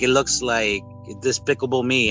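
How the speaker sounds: ordinary talk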